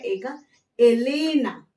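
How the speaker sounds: एलेना slow and clear, starting with a pure e; slow model word. Shown as a demonstration. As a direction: spell out एलेना slowly, open e. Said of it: "pronunciation भी देखिए कैसे निकल रहा है".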